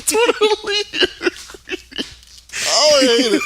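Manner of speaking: high, loud, laughing through words. Real, not acted. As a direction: crack up while saying it. Cracking up at the name.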